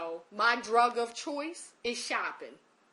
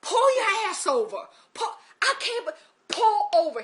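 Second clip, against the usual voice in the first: imitating his loud, high-pitched yell; acted-out exasperated holler, well above normal voice; cursing at her; 11 semitones higher, 10 dB louder than usual. Man yelling at crying caller to pull over, cursing shout. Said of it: "he kinda cursed at me".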